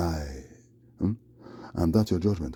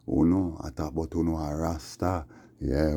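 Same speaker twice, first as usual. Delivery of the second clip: normal talking voice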